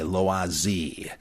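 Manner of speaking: "Loisy" as lwah-ZEE; correct French pronunciation. Shown as a demonstration. After spelling the name, "Loisy" as lwah-ZEE. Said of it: "that's Loisy".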